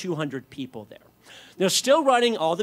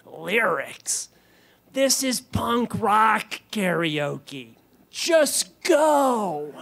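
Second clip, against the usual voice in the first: incredulous, then a slow, high shout; imitated exasperated punk host; scornful laptop guy; 5 semitones higher, much slower than usual. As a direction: build from disbelief to a drawn-out yell. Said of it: "the guy just kinda looks at me".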